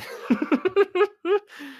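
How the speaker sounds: high cartoonish clown giggle; squeaky goofy clown laugh. Clown laugh after line.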